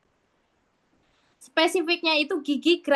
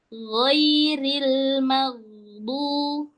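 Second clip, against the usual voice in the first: slow recitation, gh held until du; sustained tartil with a lingering ghain; much slower than usual. Shown as a demonstration. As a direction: draw out the gh of maghdu. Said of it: "tahan nih sampai bunyi du".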